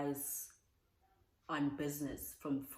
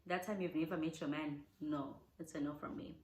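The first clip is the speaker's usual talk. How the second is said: ordinary delivery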